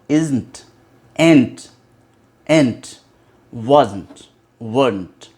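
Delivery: isn't, ain't, wasn't, weren't each short and falling; pronunciation drill. Shown as a demonstration. English teacher repeating models for learners, isolated falling contractions. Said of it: "फिर से repeat करता हूँ".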